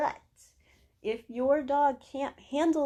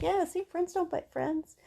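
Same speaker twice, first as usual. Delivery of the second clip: high, sing-song reply; light, high-pitched playful lilt; playful agreement; 7 semitones higher than usual. Retold or imitated. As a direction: cheerfully confirm it in the high pet-coaxing voice. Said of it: "I'm like".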